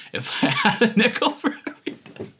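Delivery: laughing through the words. Real, not acted. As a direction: crack up while talking. Cracks up at repeated phrase.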